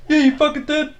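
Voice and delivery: very high, loud call from across a field; far-off shout. Retold or imitated. Friend recording in the field shouting back from afar. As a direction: yell from two hundred meters away. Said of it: "from, like, a hundred ... meters away".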